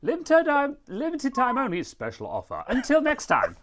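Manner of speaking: very high, loud salesman voice; Spamton. Acted. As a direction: pitch the offer in a squeaky, over-the-top character voice. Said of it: "uh, Spamton".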